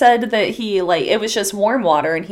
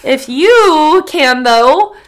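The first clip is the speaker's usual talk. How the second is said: pleading; 5 semitones higher, 8 dB louder, much slower than usual. Acted. Begging listeners for merch.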